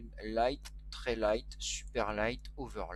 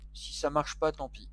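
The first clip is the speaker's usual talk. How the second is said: raised pitch, tossed off with a shrug; spoken up; 3 semitones higher than usual. Real, not acted. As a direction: lift it out of a mutter into full voice. Repeats the same phrase louder.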